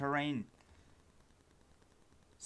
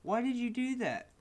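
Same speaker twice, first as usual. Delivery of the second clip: exasperated outcry; 7 semitones higher than usual. Real, not acted. Yelling at the broken program.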